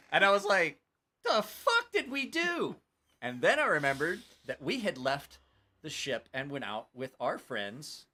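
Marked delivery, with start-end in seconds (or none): 1.2-2.8 high, loud, slow mock horror; aghast disbelief. Man seeing his cousin's tattoo, aghast disbelief. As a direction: stretch it out in hammed-up shock.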